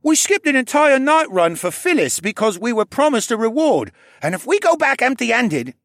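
put-on high, loud angry kid; angry boy. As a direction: blurt it out angrily. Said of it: "the angry kid stopped himself".

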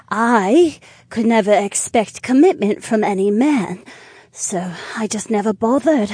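sincere tipsy woman; young woman voice. Acted. Naomi voiced.

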